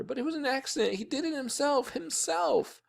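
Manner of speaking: put-on very high girl's wail, not loud; crying. A narrator's reading. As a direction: plead tearfully in a high voice. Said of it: "Sheila cried louder".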